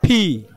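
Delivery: "ফি" with a popped puff of air; aspirated letter P. Shown as a demonstration. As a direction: burst both lips open on "ফি". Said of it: "letter হিসেবে এটার উচ্চারণ কি".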